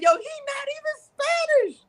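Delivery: very high squeal through laughter; supervisor's mock disbelief. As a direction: squeak it out incredulously, cracking up.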